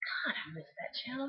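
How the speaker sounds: soft whisper, almost to yourself; whispered aside. Real, not acted. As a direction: murmur it wistfully. Nostalgic aside.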